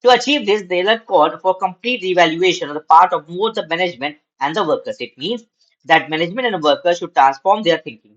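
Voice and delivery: slow, measured reading aloud to students; slow classroom read-aloud; slow textbook reading. Acted. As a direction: read each phrase out deliberately for the class.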